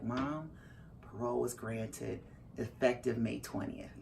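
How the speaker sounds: low, soft, matter-of-fact news; utterly calm. Retold or imitated. Son phoning mom with parole news, utterly calm. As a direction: deliver big news evenly and quietly. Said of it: "He is just utterly calm".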